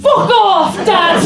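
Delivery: put-on loud, high kid yell; a kid snapping at his dad; kid's retorted shout. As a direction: blurt it at the bully. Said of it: "I said".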